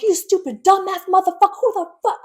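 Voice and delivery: high, loud furious outburst; going off. Retold or imitated. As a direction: blow up at them, loud and high-pitched. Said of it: "I was going off".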